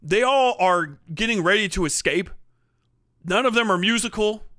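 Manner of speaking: normal talking voice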